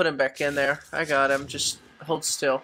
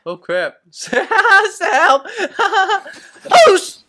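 panicked laughing scream, much higher; real panic, laughing and yelling for help; being chased; 10 dB louder, slower than usual. Real, not acted. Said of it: "Sam was being chased by a mantis".

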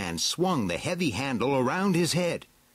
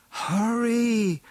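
very high, stretched-out call; urgent shout; 13 semitones higher, 4 dB louder than usual. A narrator's reading. Evelyn under the dome to Beastman, shouting urgently. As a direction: call high and long to hurry someone. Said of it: "shouted Evelyn".

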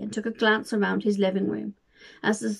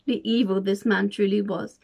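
ordinary delivery, nothing marked

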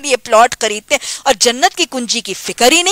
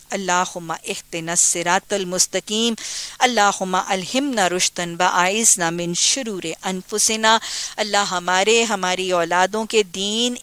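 hushed prayer; 2 semitones lower, 5 dB quieter than usual. Acted. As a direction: pray quietly and humbly for the listeners. Leads a closing supplication.

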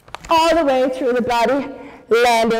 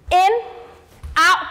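in and out called out higher and louder; punchy count calls; 6 semitones higher, 3 dB louder than usual. Real, not acted. Teacher calling the counts to the dancers, punchy and loud. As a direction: snap out the counts for the class.

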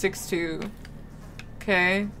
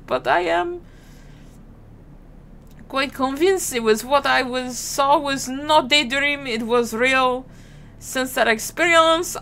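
higher-pitched, melodramatic attempt at a French accent; bad French accent; 3 semitones higher than usual. Haunted soldier requesting medical leave, bad French accent. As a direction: keep up a hammy, badly faked French accent. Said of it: "That was awful".

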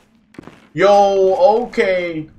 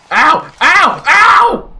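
loud, high yelps, each climbing; pained shouting; 11 semitones higher, 8 dB louder, much slower than usual. Real, not acted. Player getting speared in game, escalating yelps. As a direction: cry out louder with every hit.

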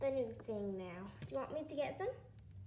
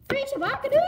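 high, loud cheer; shout-out; 10 semitones higher, 13 dB louder, faster than usual. Real, not acted. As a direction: yell the name like a cheer. Shout-out in video outro.

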